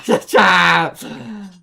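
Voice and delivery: loud falling laugh. Real, not acted. Laughing to the stream after the crash, loud laugh.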